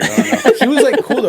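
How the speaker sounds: high, loud laughing words. Real, not acted. Telling a friend about the dealer while laughing. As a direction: laugh through it, pitch jumping up.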